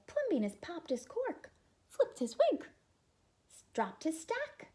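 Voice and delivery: high, loud outraged gossip; scandalized neighbors. Acted. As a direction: blurt it out like shocked neighbors.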